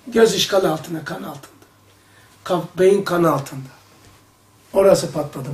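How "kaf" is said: ordinary talk, nothing marked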